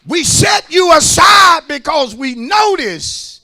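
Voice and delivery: loud sung whoop; full-force preaching. Real, not acted. Preacher calling out church workers, loud sung whoop. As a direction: chant it loud to the ushers and choir.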